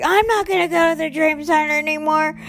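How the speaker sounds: high, fast mock tantrum; temper tantrum. Refusing the Dream Center, mock tantrum. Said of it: "throwing a temper tantrum".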